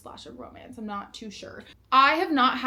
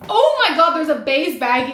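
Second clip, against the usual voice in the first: much higher, much louder squeal of delight; thrilled outburst; 4 semitones higher, 10 dB louder than usual. Real, not acted. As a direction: burst out with joy. Finds bag in box.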